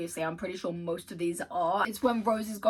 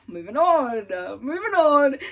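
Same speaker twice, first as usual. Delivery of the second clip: flustered; 6 semitones higher, 12 dB louder than usual. Real, not acted. Trying to compose herself.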